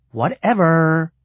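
put-on long drawn-out, dippy mall girl "whatever". A narrator's reading. Mom brushing off talk of the money, dippy mall girl voice. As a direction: draw it out like a ditzy mall girl. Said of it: "in her dippy mall girl voice".

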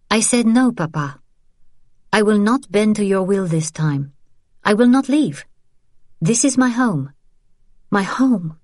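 firm, rising defiance; defiant daughter. Acted. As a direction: stand your ground, voice climbing. Daughter defies father.

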